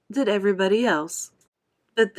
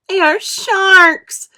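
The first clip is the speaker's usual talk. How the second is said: much higher, louder slow frightened cry; terrified cry; 12 semitones higher, 11 dB louder, much slower than usual. A narrator's reading. Earthworm crying out in terror at the sharks. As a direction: shriek the danger out. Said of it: "cried the earthworm".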